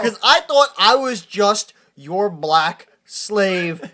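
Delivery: slow, emphatic sarcasm; mock indignation. Genie bitterly retorts to Max, slow sarcastic indignation. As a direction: draw each word out with wounded scorn.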